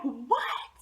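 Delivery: very high, loud, hammed-up squeal; giddy disbelief. Girl reacting to a boy's girlfriend request, giddy. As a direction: shriek through it like you just got asked out.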